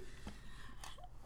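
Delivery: laughing helplessly; breathless laugh. Real, not acted. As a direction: silent wheezy laugh. Dying laughing at intro.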